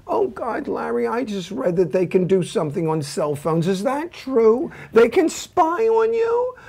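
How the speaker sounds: son's mother frets about cell phone spying, high and anxious; nervous mother. Retold or imitated. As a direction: play the fretting mother, pitch way up. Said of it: "she gets so nervous".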